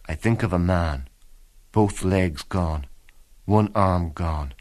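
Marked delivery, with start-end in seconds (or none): none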